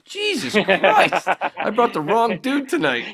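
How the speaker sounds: high, loud laughing disbelief; laughing exasperation. Retold or imitated. Fed up with David, laughing in high disbelief.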